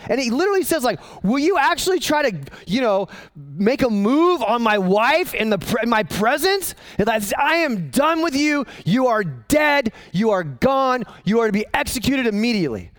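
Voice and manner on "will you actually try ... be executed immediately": furious king. Acted. The king sentences Haman for touching his wife, furious. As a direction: condemn him, spelling out each sentence.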